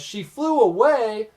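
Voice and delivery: imitated outraged scolding, pitched well up; mock yell. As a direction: yell it back in disbelief, as if quoting. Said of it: "Kazu yells".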